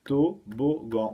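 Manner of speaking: Toboggan slowly split as TO, BO, GAN; syllable by syllable. Shown as a demonstration. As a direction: piece Toboggan together in three separate beats. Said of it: "Tôt, beau".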